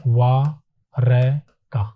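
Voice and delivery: Dwarka very drawn out, syllable by syllable; slow careful spelling-out. Shown as a demonstration. Teacher spells the word out slowly for students. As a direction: stretch Dwarka slowly, sound by sound. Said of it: "इसको इस प्रकार से लिखेंगे".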